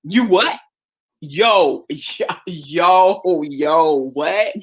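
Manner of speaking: put-on playful New York street-talk accent; hammed-up New York "yo" accent. Southerner turning New York after a call, mock New York accent. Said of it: "I'm gonna be New York for twenty minutes".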